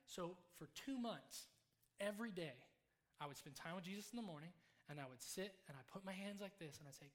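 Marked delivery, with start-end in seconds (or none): none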